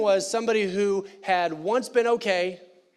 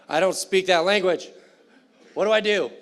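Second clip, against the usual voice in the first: loud mock confusion; 6 dB louder than usual. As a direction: play lost at Mass, exasperated.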